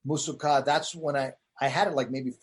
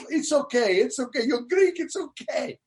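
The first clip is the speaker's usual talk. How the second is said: imitating the relieved fan; 8 semitones higher than usual. Forgiving Greek fan hugging the comic after the show, high gushing. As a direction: gush it like a fan hugging the comedian he just forgave. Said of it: "It was all".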